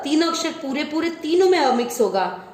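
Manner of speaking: ordinary delivery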